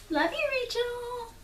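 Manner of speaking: a cooing little shout-out; soft high love-you to a friend; affectionate aside. Real, not acted. Thanks the yarn dyer.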